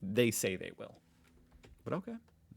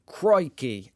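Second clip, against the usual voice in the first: put-on Australian accent; 3 semitones higher, 9 dB louder than usual. Opening the Australian comment in a mock Australian accent. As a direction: shout Crikey like a cartoon Aussie. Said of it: "I'm done with the accent".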